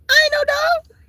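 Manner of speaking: way up, squealing; squealed protest. Acted. Indignant comic denial.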